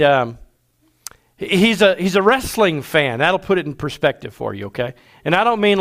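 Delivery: normal talking voice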